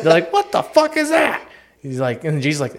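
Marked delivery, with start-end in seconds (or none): 0.3-1.4 much higher, slow disbelieving cry; mock shocked crowd. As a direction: squeal it out in horror. Crowd sees God.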